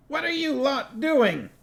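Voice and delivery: a high voice for the dwarf Cuddy, alarmed. A narrator's reading. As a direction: squeak it out like a startled dwarf.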